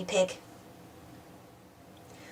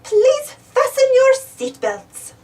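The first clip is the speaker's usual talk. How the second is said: higher, slow flight attendant voice; Miss Rabbit announcing; 11 semitones higher, 4 dB louder, much slower than usual. A narrator's reading. Flight attendant Miss Rabbit tells passengers, slow high voice. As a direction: announce it sweetly and slowly.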